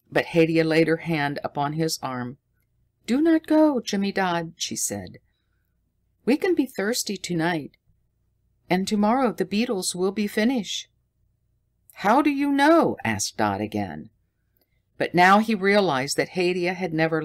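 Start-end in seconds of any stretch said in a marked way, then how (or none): 3.0-10.9 put-on higher, light girl's voice; pleading gently. A narrator's reading. Hadia begs Jimmy Dodd not to go, high and gentle. 11.9-13.0 high, louder demanding question; insistent question. A narrator's reading. Dodd presses Hadia for an answer, high and louder.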